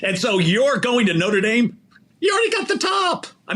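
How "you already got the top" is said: excited shout. Real, not acted. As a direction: shout it out, thrilled for him. Hyping up the coach.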